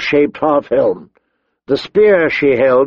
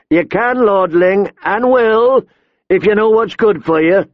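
higher-pitched, mocking menace; threatening brigand; 7 semitones higher than usual. A narrator's reading. Robber to reluctant lordling, threatening. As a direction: lean on the boy with a threat.

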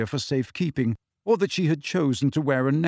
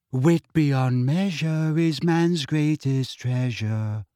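slow, lilting recitation; sing-song; much slower than usual. A narrator's reading. Luna recites to the others, sing-song. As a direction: chant it dreamily like a motto. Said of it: "in a sing-song voice".

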